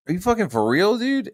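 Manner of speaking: high, rising disbelieving question; retold incredulity. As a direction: act out being dumbfounded. Retelling own incredulous question.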